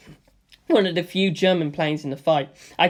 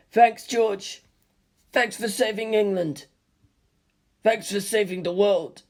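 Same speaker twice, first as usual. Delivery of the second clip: Stephen near tears; 3 semitones higher, slower than usual. A narrator's reading. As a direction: hold back tears while thanking. Stephen tearful thanks.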